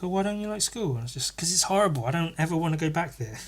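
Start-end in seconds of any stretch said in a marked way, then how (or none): 0.0-0.9 high, soft imitation of mum. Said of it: "And she was like". 1.4-3.4 put-on higher, softer little-kid voice; toddler. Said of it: "a toddler, three, four years old".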